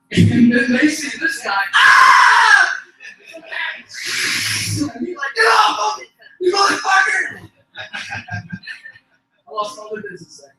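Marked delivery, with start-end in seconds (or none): 1.7-2.8 high, loud scream; mauled man's scream. Acted. Mauled man seen by shoppers. 5.4-7.5 high-pitched, frantic yell; struggling yell. Acted. Man fights off the cat.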